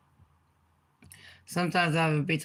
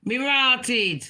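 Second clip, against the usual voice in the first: higher, louder patois exclamation me rotted; Jamaican patois; 6 semitones higher, 4 dB louder than usual. Shown as a demonstration. Showing viewers patois slang, Jamaican patois exclamation. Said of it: "that is patois".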